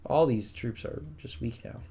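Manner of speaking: ordinary talk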